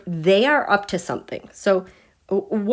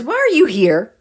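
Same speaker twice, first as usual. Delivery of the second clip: ordinary talk, nothing marked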